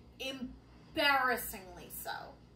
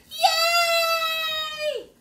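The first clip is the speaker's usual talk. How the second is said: much higher loud cheer; villagers' cheer; 13 semitones higher, 10 dB louder than usual. Acted. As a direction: cheer as the crowd.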